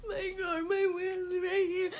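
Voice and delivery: high, slow crying voice; high tearful wail about the wheels. Retold or imitated. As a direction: sob it out to mom. Crying to mom after tire.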